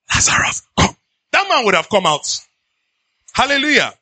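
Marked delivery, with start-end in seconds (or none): 0.1-0.9 whispered, voiceless summons; imitating Jesus calling under his breath; hushed command. Shown as a demonstration. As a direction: whisper the order, barely voiced. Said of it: "If Jesus said".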